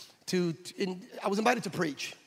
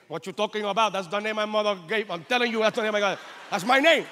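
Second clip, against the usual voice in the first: rising, louder indignant protest; 4 semitones higher, 4 dB louder than usual. Retold or imitated. Israel insisting to the officer, indignant and louder. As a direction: insist it's your real name. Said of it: "he keeps on saying".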